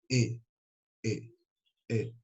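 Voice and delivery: "E" soft and closed, felt in the throat; a quiet closed "E"; closed vowel. Shown as a demonstration. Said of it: "Ele é mais fechadinho aqui". ("E" fechadinho)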